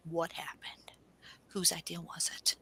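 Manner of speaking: hushed, nosy, leaning in; gossipy whisper. Retold or imitated. Nosy friend begging for weekend gossip, in a whisper.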